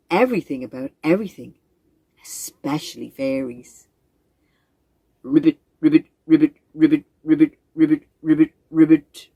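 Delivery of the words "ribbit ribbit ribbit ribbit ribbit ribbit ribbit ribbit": low, loud croaked ribbits; frog croaking. Acted. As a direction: croak like a big pond frog.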